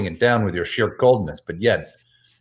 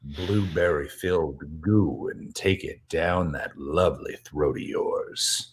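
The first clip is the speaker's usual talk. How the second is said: creepy sexy voice; 5 semitones lower, 7 dB quieter than usual. Acted. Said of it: "Creepy sexy voice".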